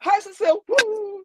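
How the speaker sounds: high, giddy cheer; mock excited greeting. Teasing her friend about next year, mock giddy cheer. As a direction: ham up an overexcited hello. Co-host mocks her future self.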